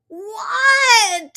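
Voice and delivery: high, loud yell; yelled What. Acted. Froggy shouts back to Mom, high and loud. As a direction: call back from afar.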